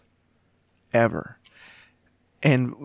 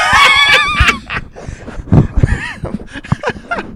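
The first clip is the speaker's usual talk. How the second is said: high squealing laugh; startled laughing shriek; 6 dB louder than usual. Real, not acted. Mic falls, laughing shout.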